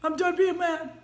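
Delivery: a hint of a kid letting the anger go; calmed-down child. Niece telling her daddy she's done, lightly imitated child voice. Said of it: "I remember hearing my niece saying".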